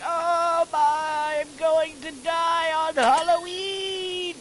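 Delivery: put-on high, slow wailing old granny; geriatric Irish grandma. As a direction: wail dramatically as an ancient grandma, drawn out. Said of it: "hundred-year-old geriatric grandma from Ireland".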